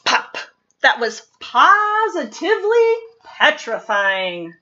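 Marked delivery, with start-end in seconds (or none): none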